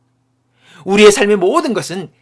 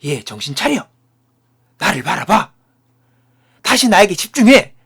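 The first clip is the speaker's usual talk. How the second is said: building to a raised, commanding call; loud wake-up call; 6 dB louder than usual. Acted. God calling the straying believer to attention, loud and commanding. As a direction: act out a loud rebuke, firmer with each order. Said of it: "큰 소리로 말씀하시는 것입니다".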